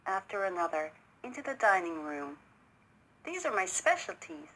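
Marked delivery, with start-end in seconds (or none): 3.2-4.5 higher, proud host's boast; proudly. A narrator's reading. Host presents his dishes to his guest, proudly. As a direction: show off your cooking. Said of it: "he said proudly".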